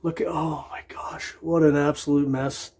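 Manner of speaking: soft breathy whisper, as if not to be overheard; hushed whisper. Real, not acted. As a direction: whisper it under your breath, peeking in. Quiet peek at the messy room.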